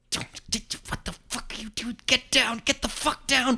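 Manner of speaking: starts as a hissed whisper, rises to a high shouted yell. Retold or imitated. Son warning his dad as the moose appears, whisper to shout. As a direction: panic building from whisper to shout. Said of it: "Yelling at your dad?".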